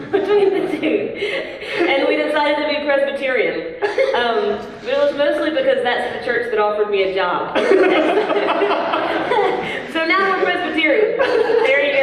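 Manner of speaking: giggling through the words, pitch up; laughing. Real, not acted. Guest laughing while explaining her church choice. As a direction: laugh as you tell it, amused at yourself.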